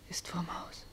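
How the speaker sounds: barely voiced, stunned hush; horrified whisper. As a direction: whisper the dreadful discovery. Finds brother hanged outside.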